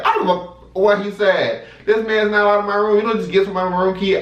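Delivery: high-pitched exasperated retort, retold; cussing her out. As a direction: re-enact snapping back at the clerk. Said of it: "I'm cussing her out".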